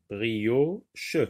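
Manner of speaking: "Brioche" as two beats, brio, che; split into syllables. Shown as a demonstration. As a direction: pause inside "Brioche" before che. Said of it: "voici les mots séparés".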